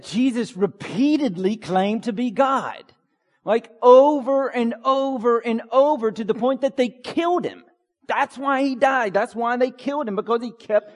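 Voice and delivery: rising insistent preacher; emphatic preaching. Acted. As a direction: drive home that he claimed to be God. Preacher insists on key point.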